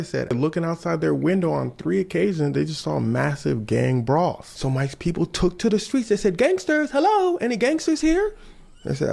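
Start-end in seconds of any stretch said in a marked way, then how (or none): 6.4-8.3 timid high calling out. Acted. Crew calling out on streets.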